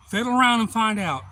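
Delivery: high, loud mock warning; retold threat. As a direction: call out the dare to a stranger. Retold threat back at the stranger.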